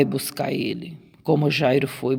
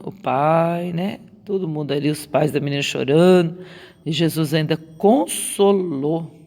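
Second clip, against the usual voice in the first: usual speaking voice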